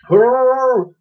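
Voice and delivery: high, loud beast call; Wooly joining the fight; mammoth grunt. Acted. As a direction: trumpet like a mammoth. Mammoth's noise.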